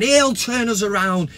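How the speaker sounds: ordinary talk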